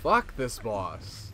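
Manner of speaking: slow, dragged-out frustration; fed-up groan. Real, not acted. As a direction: draw it out, utterly fed up. Losing to boss again.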